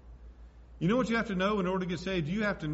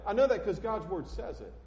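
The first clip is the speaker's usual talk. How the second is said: usual speaking voice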